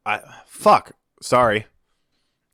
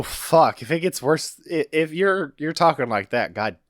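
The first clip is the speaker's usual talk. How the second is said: usual speaking voice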